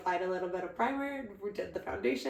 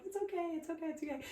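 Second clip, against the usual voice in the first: soft soothing; 7 semitones higher, 10 dB quieter, much faster than usual. Retold or imitated. Sister soothing quoted.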